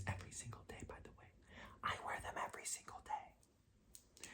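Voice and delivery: confessional whisper; whispered aside. Real, not acted. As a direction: whisper it like a little secret. Confiding aside about sweatpants.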